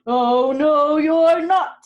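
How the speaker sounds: loud troll boom. A narrator's reading. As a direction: bellow it out as the hungry troll. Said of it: "said the troll".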